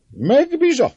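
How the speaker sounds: put-on very high, loud drunken cry; drunken old man. A narrator's reading. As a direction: shout it while barely standing. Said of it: "az asztalba fogódzva, hogy el ne essék".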